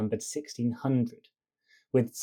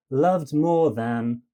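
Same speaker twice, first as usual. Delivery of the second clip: "loved more than" slow, LOVED and MORE stressed, "than" light; two heavy, one light; 4 dB louder, slower than usual. Shown as a demonstration. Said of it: "palimbacus is".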